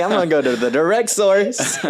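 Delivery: retelling his bold plan while laughing; laughing quote. Retold or imitated. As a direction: say it through giggles. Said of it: "I was like".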